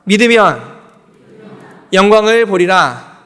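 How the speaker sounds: 회중이 따라하도록 힘주어 선포; call to repeat. Shown as a demonstration. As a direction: proclaim it for the congregation to echo. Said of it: "따라해 봅시다".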